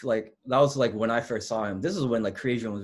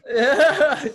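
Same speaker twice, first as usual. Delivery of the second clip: loud high burst of laughter; 11 dB louder than usual. Real, not acted. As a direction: let out a big high laugh. Teller laughs at own story.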